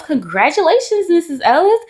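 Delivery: high, bright-announcing nurse, slowing down on the news; cheerful nurse. Retold or imitated. Nurse at the six-month checkup announcing the weight gain, high and slow. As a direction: deliver the news like a beaming nurse, drawn out. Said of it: "she goes".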